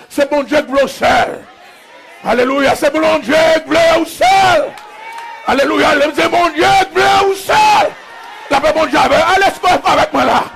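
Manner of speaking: rising pulpit shout with stretched cries; full-force preaching. Real, not acted. Preacher telling the lonely God wants them, shouted preaching. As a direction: build each repeated line into a shout.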